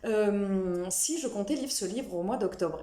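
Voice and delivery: ordinary delivery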